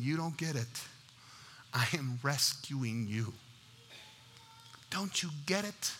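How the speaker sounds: soft, patient voice of God; gentle explaining. Acted. God to people who complain, softly and patiently. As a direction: quietly reason with them, leaving space between phrases.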